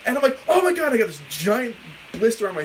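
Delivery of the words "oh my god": high, loud retold alarm; mock shocked outcry. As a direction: exclaim at your freshly burnt thumb. Reenacts shock at his burn.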